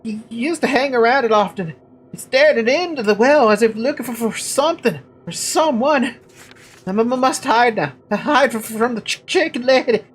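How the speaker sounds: high, halting villager stammer; pleading simpleton with a put-on stutter; stuttering character voice. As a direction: trip over first sounds in a high, eager voice. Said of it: "That's really hard to do".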